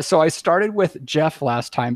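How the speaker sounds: ordinary talk, nothing marked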